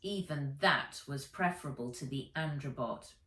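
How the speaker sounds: ordinary delivery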